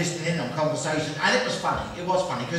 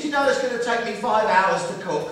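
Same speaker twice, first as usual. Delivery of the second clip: usual speaking voice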